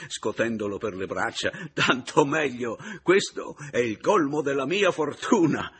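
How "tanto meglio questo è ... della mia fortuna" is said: high, very loud mock jubilation; bitter shouting. A narrator's reading. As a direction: shout your luck with wild bitterness. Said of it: "gli gridai".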